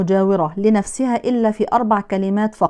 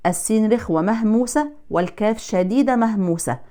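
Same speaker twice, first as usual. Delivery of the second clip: ordinary talk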